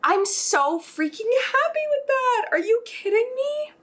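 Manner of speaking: can't believe it worked, squeal it; thrilled squeal. Real, not acted. Baker overjoyed at her first loaf, squealing joy.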